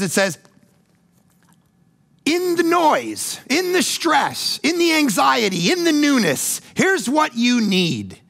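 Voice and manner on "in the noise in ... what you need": slow, high, each phrase weighted; emphatic reading. Real, not acted. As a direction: lay out the list deliberately. Reading psalm dramatically.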